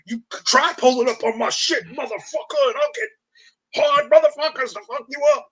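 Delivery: mock the band member daring people; mock tough talk. Band member on stream daring fans to show up, hammed-up tough dare. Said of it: "the member of the band did say".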